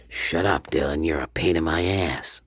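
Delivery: low, soft device putting someone down; hushed, low joke assistant; mock Alexa. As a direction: insult them in a low, quiet put-on voice. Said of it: "it probably was the Alexa".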